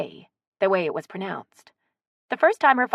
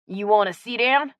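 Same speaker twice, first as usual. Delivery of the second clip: louder, sociable Cajun voice; strong Cajun accent; 4 semitones higher, 5 dB louder than usual. A narrator's reading. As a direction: offer a seat in broad Cajun. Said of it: "in his strong Cajun accent".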